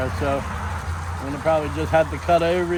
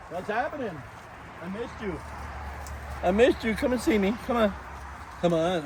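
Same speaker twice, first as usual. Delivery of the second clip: pet cooing; 3 semitones higher, 7 dB quieter than usual. Real, not acted.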